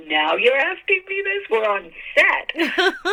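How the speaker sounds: high-pitched disbelief, last words stretched out; retold incredulous protest. Her retort to the director, retold.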